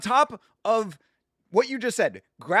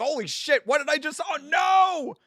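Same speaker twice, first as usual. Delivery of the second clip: mock panic; 10 semitones higher than usual. Streamer acting out a slip-up on stream, mock panicked yell. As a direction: play the horror of catching your own slip. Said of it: "you're like".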